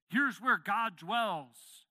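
loud, high, slow and weighty; slow emphatic proclamation. Real, not acted. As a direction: declare it word by word. Preacher emphasizes.

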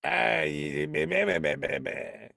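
mocking imitation of the corpse's speech; mock creature babble. Player imitating the corpse as he enters, mocking babble. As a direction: babble nonsense like a droning creature. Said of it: "he's like".

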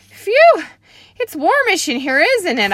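very high, loud nervous exclamation; nervous sweaty kid. Acted. Junie B. sweating before the typing lady, high loud nervous phew. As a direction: fan yourself and fake being casual.

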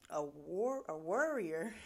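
"warrior" fumbled through a laugh; a tongue-twisted "warrior" with a laugh; laughing slip. Real, not acted. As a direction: laugh while saying "warrior". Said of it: "I'm getting tongue twisted".

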